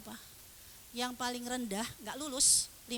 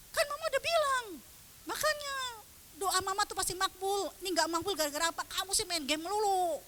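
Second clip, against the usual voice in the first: high, mock scolding rant; nagging mother; 8 semitones higher than usual. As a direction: scold the child in a raised, high voice. Scolding mother acted as bad example.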